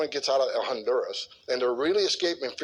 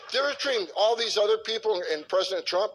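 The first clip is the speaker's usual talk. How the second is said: ordinary talk, nothing marked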